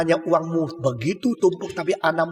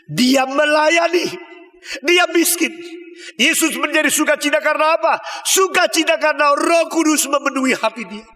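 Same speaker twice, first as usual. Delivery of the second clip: preaching at full force; 10 semitones higher, 4 dB louder than usual. Acted. Preacher telling the church why Jesus rejoiced, fervent loud preaching. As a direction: lift the congregation with each line.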